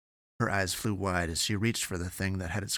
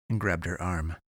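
ordinary delivery

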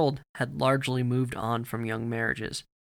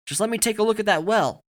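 high, raised-voice appeal; calling out; 9 semitones higher, 6 dB louder than usual. A narrator's reading. Soldier asking a hostile boy for access, calling out. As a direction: call across to someone, pitched up and loud.

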